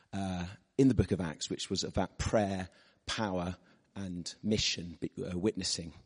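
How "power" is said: ordinary talk, nothing marked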